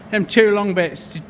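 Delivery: ordinary talk, nothing marked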